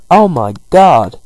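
loud, slow, drawn-out mock shock; hammed-up horror; shocked exclamation. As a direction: gasp it out big and stretched. Onlooker reacts to the angry row.

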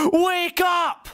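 drawn-out high-pitched yell; shouted wake-up. Pretend. Man nagging himself out of bed, high shouted wake-up. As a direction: holler it slow and high at a lazy sleeper.

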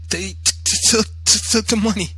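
high, stammering gasps; gasping. A narrator's reading. Beaten boy meeting mother at the door, gasping stammer. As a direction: choke out broken syllables like a sobbing, breathless boy. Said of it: "I gasped".

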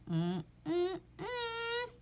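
very soft high rising hum; whimpering yawn hum. Acted. The reader fights a yawn, soft high humming.

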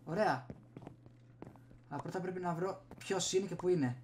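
ordinary talk, nothing marked

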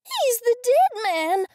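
high, loud squeal. A narrator's reading. Goldie pointing at the ghost, high loud squeal. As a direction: squeal it in alarm. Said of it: "I squealed".

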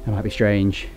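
ordinary talk

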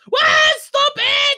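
loud, high protest; exasperated yelling. Acted. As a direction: yell sharply in indignation.

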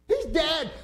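high, loud panicked yell; panicked cry. Retold or imitated. Passenger yelling that the pilot is dead, panicked.

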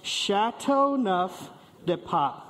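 Chateauneuf du Pape drawn out slowly, syllable by syllable. Shown as a demonstration. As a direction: model Chateauneuf du Pape for the group to repeat. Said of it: "everybody say it with me".

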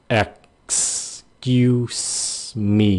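syllable by syllable "Excuse me", s sounds stretched; two hissed s sounds. Shown as a demonstration. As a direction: EXCUSE broken up with long hissing s's. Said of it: "hai cái âm xì".

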